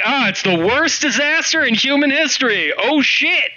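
high, breezy mock newscaster; news anchor reporting disaster far too casually; cavalier newscaster. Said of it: "they're like".

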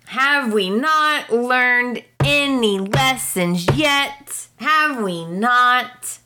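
real exasperation at the show; exasperated. Real, not acted. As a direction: plead with the characters, fed up. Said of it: "I cannot handle this".

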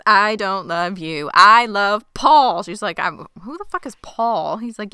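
loud, slow mock declaration; hammed-up emphatic confession; emphatic rejection. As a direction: punch out each word of the retold rejection. Retold rejection, shoving her off.

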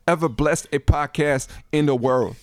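high, loud bragging voice; boastful artist voice. Retold or imitated. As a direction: brag like a superstar.

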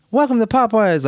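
high, bright drive-through greeting; overly cheerful welcome; enthusiastic greeting. Retold or imitated. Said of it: "in the most enthusiastic way".

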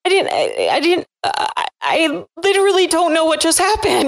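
high, fumbling apology; flustered friend. Retold or imitated. Friend Kristen apologizing after the meltdown, high and flustered. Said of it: "Kristen, was like".

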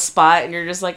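normal talking voice

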